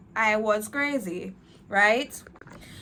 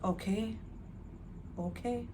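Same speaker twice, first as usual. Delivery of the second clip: soft Lord's reply; 11 dB quieter than usual. Retold or imitated. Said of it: "and he said".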